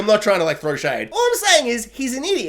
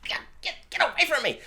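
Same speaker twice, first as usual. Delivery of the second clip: mock shooing; 10 semitones higher, 5 dB quieter, faster than usual.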